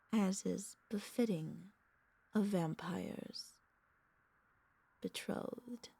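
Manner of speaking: slow, soft, savoured words; hushed, lingering reverence; tender correction. Acted. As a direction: revise it gently, pausing before the last word. Tenderly revises pet to betrothed.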